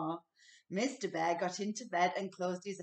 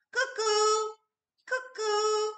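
high, bright cuckoo call; cuckoo clock call; 13 semitones higher, 6 dB louder, slower than usual. A narrator's reading. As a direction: chirp it like a clock bird.

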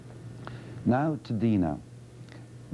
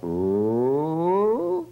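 ooh gliding steadily upward and louder; rising ooh; 8 semitones higher, 4 dB louder than usual. Shown as a demonstration. Therapist showing a rising pitch glide. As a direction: slide the ooh up. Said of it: "and you go up".

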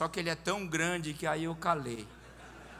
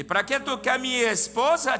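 loud, high challenge; imitated indignant husband; 7 semitones higher, 11 dB louder than usual. Big man challenged about his wife, loud indignant demand. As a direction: demand it with a raised mock bellow.

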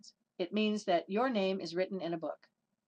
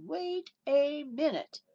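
commanding wait; 7 semitones higher, much slower than usual. Retold or imitated. Paul stops the whipper mid-swing, slow and commanding. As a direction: halt the whipper with authority. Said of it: "Opened up his mouth and said".

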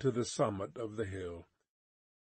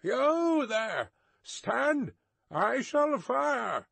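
shouting; 10 semitones higher, much slower than usual. A narrator's reading. Guard challenges unseen rider.

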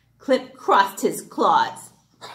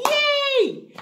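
cheer; 3 dB louder than usual. Real, not acted. Cheering after poem.

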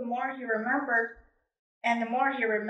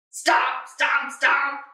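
stomping steps; 4 dB louder, much slower than usual. Acted. Approaching footsteps sound effect.

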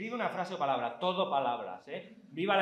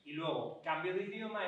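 ordinary talk

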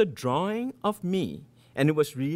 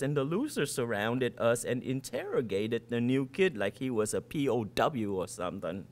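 usual speaking voice

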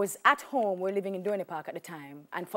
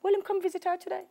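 neighbor's dialect remark; 10 semitones higher, faster than usual. Retold or imitated. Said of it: "she's like".

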